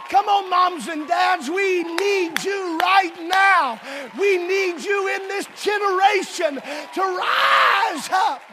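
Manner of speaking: loud, high, slow rallying cry; fervent appeal. Real, not acted. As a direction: call the parents up with force. Preaching at full force.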